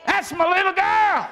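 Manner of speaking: high, loud cheer from the stands; cheering grandpa. Pretend. Grandpa cheering his granddaughter at her ball game, loud and high. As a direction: holler it out like you're in the bleachers. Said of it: "I'm gonna go".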